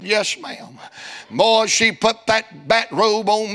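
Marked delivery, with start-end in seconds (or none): 0.0-0.8 soft, timid reply; meek yes ma'am. Retold or imitated. Preacher meekly answering the hairdresser, soft and timid. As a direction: shrink and answer softly. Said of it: "I said".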